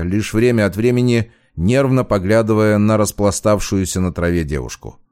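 plain reading voice

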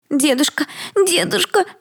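put-on a little girl crying for her grandfather; child crying. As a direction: wail for grandpa like a small child. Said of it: "заплакала Белль".

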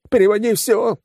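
very high, frantic shout; hysterical yell. A narrator's reading. Gang chief at gunpoint ordering the transfer, yelling hysterically. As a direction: yell it in hysterics, pitch shooting up. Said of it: "заорал главдятел истерично".

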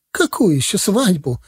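high, strained disbelief; mildly cracking, upset question; voice breaking. A narrator's reading. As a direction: ask as if the voice is giving way. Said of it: "срывающимся голосом спросила сестра".

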